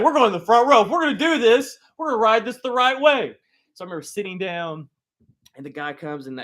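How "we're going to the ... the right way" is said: bold dad. Retold or imitated. Dad picks the front row.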